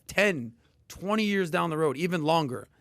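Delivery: usual speaking voice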